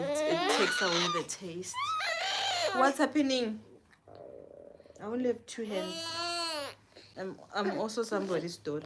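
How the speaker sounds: exasperated. Real, not acted. Overwhelmed mother, crying babies.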